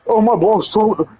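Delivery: high, drawn-out mock whine; mocking high kid voice; whiny crybaby. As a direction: imitate a crybaby complaining about the boss. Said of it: "like a crybaby, man".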